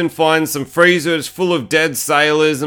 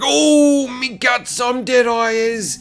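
high mock death wail, trailing slower; hammed-up dying captain; 9 semitones higher, much slower than usual. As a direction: groan out a comic death, high and drawn out. Retold captain stabbed, dying.

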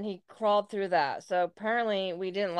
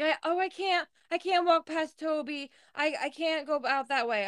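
higher, whiny mock panic; mock scared whine; 8 semitones higher than usual. Imitating the frightened intruder afraid of Toby, higher whiny pleading. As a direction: imitate a scared guy begging not to go. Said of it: "He's like".

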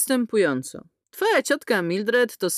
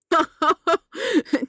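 manic laugh; 9 semitones higher than usual. A narrator's reading. Mildred's scream-laugh.

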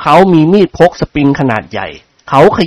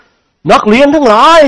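much higher, louder, very slow pompous call; formal address; 10 semitones higher, 4 dB louder, much slower than usual. A narrator's reading. Headmaster formally addressing the unruly class, slow pompous.

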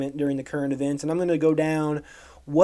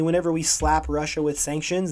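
normal talking voice

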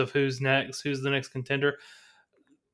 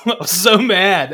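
laughs through it, louder; louder, higher, laughing at his own anger; 7 semitones higher, 10 dB louder than usual. Real, not acted. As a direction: laugh out the memory of being mad. Laughing while recalling anger.

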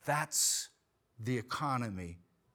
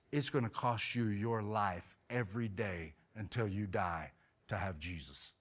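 low, soft and slowing; solemn challenge; 6 semitones lower, 5 dB quieter than usual. Retold or imitated. Homeowner telling the salesman the cost of faith, solemn. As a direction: lay it down gravely, easing to a hush.

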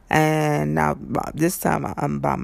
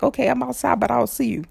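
quoting her own call, pitched higher; replaying what she told the driver, a little up; retold own call; 8 semitones higher, faster than usual. To the driver, retelling her own words higher.

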